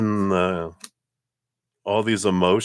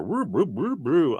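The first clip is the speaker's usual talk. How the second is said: wobbly noise; 9 semitones higher than usual. Retold or imitated. Reader illustrating unstable hungover feelings, swooping wobbly noise. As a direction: pitch swooping up and down.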